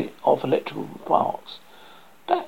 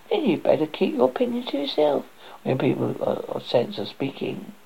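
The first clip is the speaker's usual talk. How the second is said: snooty bored cat; 9 semitones higher, 4 dB quieter than usual. Acted. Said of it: "the cat said".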